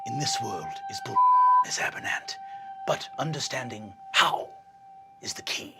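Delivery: whispered wisdom; hushed whisper. Acted. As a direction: share the secret in a whisper.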